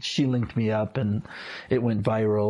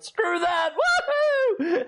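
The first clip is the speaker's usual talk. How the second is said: much higher, daring shout; defiant whoop. Retold or imitated. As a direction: throw caution off with a high yell. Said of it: "And I'll be like".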